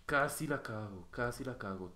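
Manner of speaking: usual speaking voice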